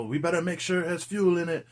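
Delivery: a light hint of the Jamaican pilot's accent. Reviewer quoting the helicopter pilot, light Jamaican accent. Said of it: "He's got this Jamaican accent".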